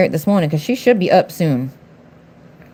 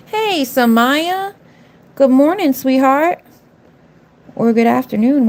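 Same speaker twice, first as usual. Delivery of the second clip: high, drawn-out sing-song hello; sweet greeting; 6 semitones higher, slower than usual. Real, not acted. Streamer greeting a viewer who joined, sweet sing-song hello. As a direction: greet her like a dear friend.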